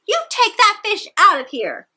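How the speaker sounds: high, loud yell at the fish; angry crowd yelling. A narrator's reading. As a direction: shout them out of the pool. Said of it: "they yelled".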